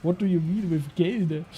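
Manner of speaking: normal talking voice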